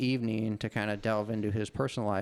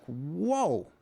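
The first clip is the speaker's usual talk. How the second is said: put-on Finnish accent; 6 semitones higher than usual. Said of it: "the guy in his accent".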